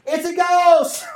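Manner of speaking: slow, high, shouted cry of fright; frightened cry. Acted. Terrified disciples in the storm, crying out high and loud. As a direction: cry out as if you saw a ghost. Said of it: "they were troubled, saying".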